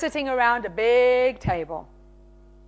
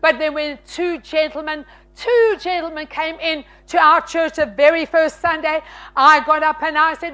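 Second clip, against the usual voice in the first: usual speaking voice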